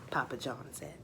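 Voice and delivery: ordinary delivery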